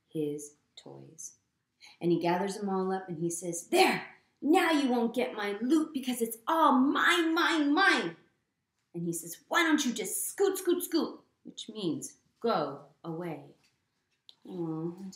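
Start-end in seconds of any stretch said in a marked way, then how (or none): 3.7-8.2 loud, high storybook pug voice; hammed-up greedy yell; gloating possessive shout. Greedy Pig guards his pile. 9.5-11.2 high, loud dismissive pug voice; hammed-up storybook brush-off; bossy shooing. Pig shoos Trevor away.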